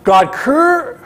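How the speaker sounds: high and pushed, words stuck; mock prophet choking on the curse; hammed-up struggle to curse; straining, blocked cursing. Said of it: "So he's like".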